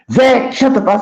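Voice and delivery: high, heated repeat, pushed harder; angrier retort. Retold or imitated. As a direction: yell it the second time like he's losing it. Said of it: "So he talking about".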